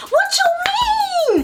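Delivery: high, slow, squeaked disbelief; can't believe what she just said; shocked disbelief. Retold or imitated. Asking the roommate, high-pitched stunned disbelief.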